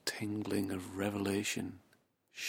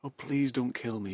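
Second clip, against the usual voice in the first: higher, louder frightened cub begging; bleating plea; 3 semitones higher, 5 dB louder than usual. Acted. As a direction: plead for your life like a scared little sheep. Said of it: "bleated the cub".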